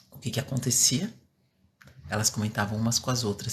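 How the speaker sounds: soft, low-volume demo voice; speaking softly. Shown as a demonstration. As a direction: show how gently she learned to speak. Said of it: "Eu comecei falando baixo".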